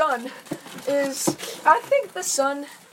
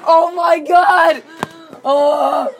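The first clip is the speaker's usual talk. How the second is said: mock alarm; 5 semitones higher, 9 dB louder, much slower than usual. Pretend terror.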